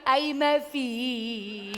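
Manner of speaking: slow, drawn-out lament; hammed-up mother's moan; mock wailing mother. Mère qui pleure. (lamentation de mère exagérée)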